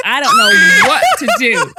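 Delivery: high, loud, laughing wail; fed-up mother crying out to Maury; mum's despairing shout. Retold or imitated. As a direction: break into a desperate cry through laughter. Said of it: "the mum is just like".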